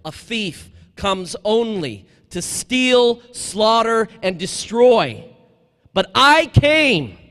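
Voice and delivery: slow, loud, drawn-out scripture reading; emphatic scripture. Acted. Priest reading scripture to the crowd, slow and loud. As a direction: proclaim each verb slowly and loudly. Preacher quoting John ten ten.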